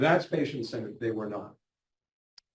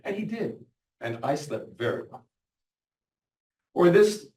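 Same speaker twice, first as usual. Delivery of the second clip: ordinary talk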